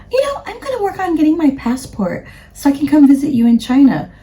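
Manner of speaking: high, light imitation of mom. Daughter imitating her mom's sweet promise, high and light. Mom voiced in retold chat.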